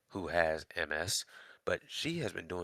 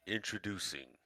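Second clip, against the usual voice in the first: low, soft movie-trailer voice; a hushed, deep announcer intro; 8 dB quieter than usual. Shown as a demonstration. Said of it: "let me do that again".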